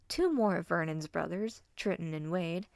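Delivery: ordinary delivery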